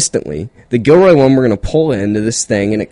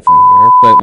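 loud outburst; 11 dB louder than usual. Real, not acted. Sudden outburst.

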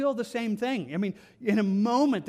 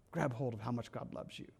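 "Grab hold of how much God loves you" said again much softer and lower, almost under the breath; hushed repeat; 9 semitones lower, 11 dB quieter than usual. Shown as a demonstration. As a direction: repeat it softly, drawing the listener in.

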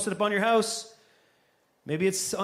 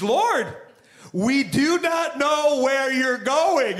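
higher and slow, every word drawn out; slow unhinged; 6 semitones higher, 4 dB louder, much slower than usual. Retold or imitated. Thomas exasperated at Jesus, said slowly. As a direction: spell it out slowly as if to someone slow. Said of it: "he's coming a little unhinged".

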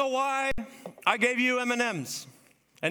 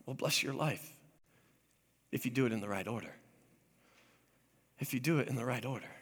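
quiet God voice; 11 semitones lower, 8 dB quieter, faster than usual. Acted. God voiced gently, confiding.

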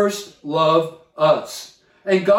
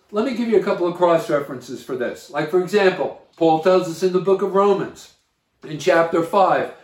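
ordinary delivery

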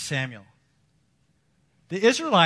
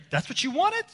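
incredulous God; 12 semitones higher than usual. Retold or imitated. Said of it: "And he'll say".

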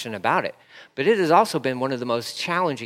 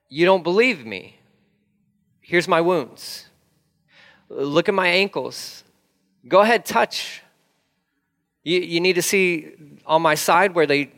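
raised, emphatic Jesus offering his wounds; voicing Jesus; 4 semitones higher than usual. Jesus urging a doubting Thomas, emphatic. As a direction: lift the voice, insistent and inviting.

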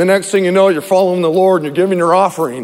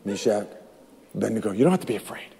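soft reassurance; 8 semitones lower, 7 dB quieter, faster than usual. Acted. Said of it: "God said".

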